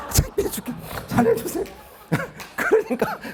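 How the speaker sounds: helpless high-pitched laughter through the words; laughing. Real, not acted. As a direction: barely get it out for laughing. 창피했던 일을 말하며 웃음. (웃음이 터져 높아진 목소리)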